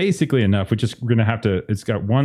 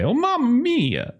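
exclaiming butcher; 14 semitones higher, slower than usual. Acted. Butcher reacts to being hammered by the goat, hammed-up exclamation. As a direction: stretch it out high, hammed-up. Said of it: "definitely the butcher's gonna say".

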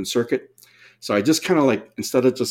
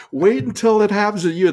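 warning, high; 11 semitones higher, 4 dB louder than usual. Retold or imitated. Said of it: "like, going".

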